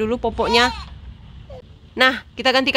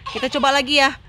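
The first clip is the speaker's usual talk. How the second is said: ordinary talk, nothing marked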